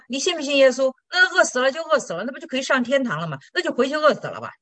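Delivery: hammed-up pastor telling her to go starve; pastor's blunt advice. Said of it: "牧师说". (高声调侃地扮演牧师)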